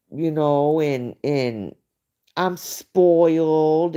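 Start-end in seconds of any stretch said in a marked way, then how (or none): 2.4-4.0 slow, drawn-out spoiled-child imitation; mock spoiled kid. Mocking a woman acting like a kid, spoiled. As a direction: stretch it out like a spoiled brat. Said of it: "acts like she wants to be a baby and a kid".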